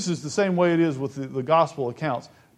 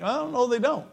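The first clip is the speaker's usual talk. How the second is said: doubtful objection; 8 semitones higher than usual. Acted. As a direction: voice a doubter's objection in a higher pitch. Voices a doubting listener's objection.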